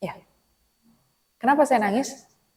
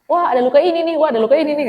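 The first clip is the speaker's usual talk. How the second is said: mock delighted collector; 6 semitones higher, 6 dB louder, faster than usual. Mocking someone collecting their wounds.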